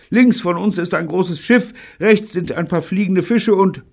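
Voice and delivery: very high, held-pitch reporting; radio report. A narrator's reading. David describing his position to Neil, high calling. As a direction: list sightings in a high calling voice.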